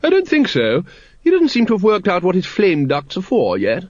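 high-pitched, gushing lady of rank; hearty grand lady. Acted. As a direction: introduce the pet in a high, ladylike voice. Voices Lady Ramkin.

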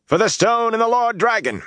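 high, ringing rallying cry; very high-pitched battle call; war cry taken up. A narrator's reading. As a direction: cry it out as soldiers joining in. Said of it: "Others took it up".

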